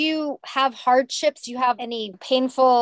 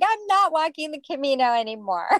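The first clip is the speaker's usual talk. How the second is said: laughing it off, a bit higher; 6 semitones higher than usual. Retold or imitated. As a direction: back out of the plan with a laugh. Retold change of mind, laughing.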